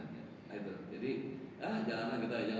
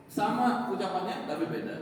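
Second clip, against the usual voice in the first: normal talking voice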